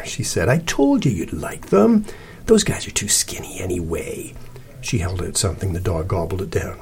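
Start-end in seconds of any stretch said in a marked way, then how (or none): 0.5-4.3 coaxing. A narrator's reading. Cece feeding the dog treats, coaxing. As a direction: sweet-talk the dog conspiratorially.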